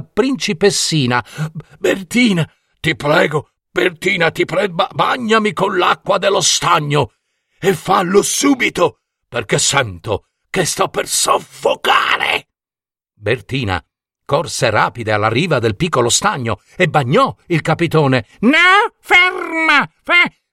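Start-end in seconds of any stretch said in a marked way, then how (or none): 1.8-12.5 high-pitched creature begging for water; urgent pleading. Acted. Creature begging, about to suffocate. 18.4-20.4 high, loud witch's scream to stop; shouted alarm. A narrator's reading. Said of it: "gridò Euriale".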